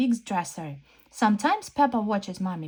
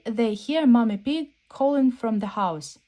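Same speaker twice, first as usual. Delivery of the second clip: ordinary delivery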